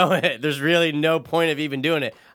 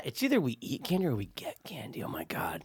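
whisper; 3 semitones lower, 13 dB quieter than usual. Real, not acted. Whispered aside.

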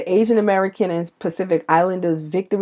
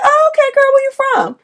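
put-on eager girlfriend greeting. Brooklyn woman meeting a supposed fellow New Yorker, eager. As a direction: chirp it like greeting a long-lost friend.

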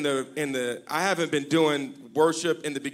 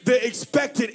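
higher, half-sung preaching; full-force preaching; 9 semitones higher, slower than usual. Acted. Pastor quoting Paul to the church, half-sung preaching. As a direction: climb into a singing preach.

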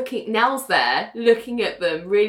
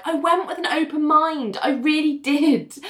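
higher, insistent self-defence; earnest protest; 6 semitones higher than usual. Retold or imitated. Ali defending herself about therapy, earnest protest.

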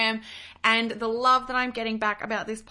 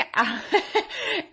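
bright laugh; 6 semitones higher than usual. Real, not acted. Laughing about the Facebook Live.

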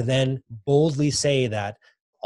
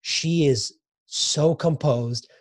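usual speaking voice

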